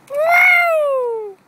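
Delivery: high, loud whoop; cheering the dogs; excited whoop. Real, not acted. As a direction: let out a happy whoop. Cheering the dog on.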